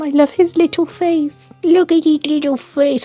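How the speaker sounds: high cooing over something cute; overwhelmed by cuteness. Real, not acted. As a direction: gush like seeing a puppy. Gushing over cute bat sticker.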